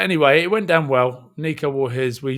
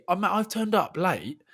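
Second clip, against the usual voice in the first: ordinary delivery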